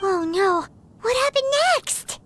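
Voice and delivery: high-pitched, alarmed question; anxious exclamation. Acted. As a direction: burst out, desperate to hear the rest. Child reacts anxiously to the tale.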